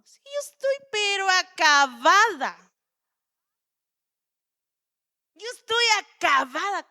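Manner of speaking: put-on high, loud, worn-out old woman; hammed-up Sara bewailing her age; Sara lamenting. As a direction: wail it as the acabada old wife. Preacher acts out Sara's lament.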